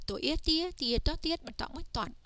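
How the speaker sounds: chain "To, Ia" into "Tia", on to "Toan"; teacher sounding out "Toan" in linked steps; spelling-out demo. Shown as a demonstration. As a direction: recite the letters, blending up to "Toan". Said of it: "just like this".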